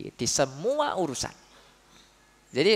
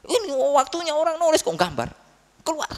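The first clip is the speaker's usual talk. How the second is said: higher-pitched mock scolding of a pupil; scolding teacher; 10 semitones higher than usual. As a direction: lightly play the harsh teacher telling the child off. Harsh teacher scolds child. (guru galak memarahi murid, pura-pura marah dengan nada tinggi)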